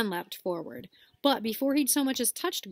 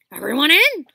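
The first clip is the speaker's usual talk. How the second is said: very loud, higher shout; 7 semitones higher, 15 dB louder than usual. Acted. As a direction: bellow the order. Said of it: "shouted Hagrid".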